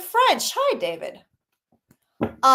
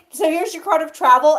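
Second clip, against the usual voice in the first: ordinary delivery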